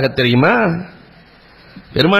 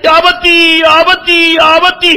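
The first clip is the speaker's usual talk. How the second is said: high, loud, drawn-out cry for father; child's scream; 12 semitones higher, 6 dB louder than usual. Retold or imitated. Buried daughter crying out to her father, high anguished scream. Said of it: "என்று அலறிய அலறல்".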